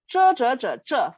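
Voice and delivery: "zhe" repeated with a sharp fall; fourth tone. Shown as a demonstration. Teacher drills students on "zhe", falling fourth tone. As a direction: hit each "zhe" firmly and drop the pitch. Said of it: "fourth tone here".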